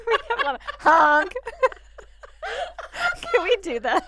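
high, loud laughing voice. Real, not acted. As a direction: giggle through every word. Giggling fit over honk idea.

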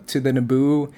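ordinary talk

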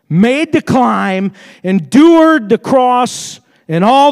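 ringing pulpit proclamation; impassioned preaching. Real, not acted. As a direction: preach it at full force.

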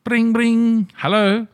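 high, loud mock telephone caller; old-time phone gag. The nineteen forties calling for their joke back, high mock caller. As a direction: imitate a ringing phone, then a high caller. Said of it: "other shows say it when they go".

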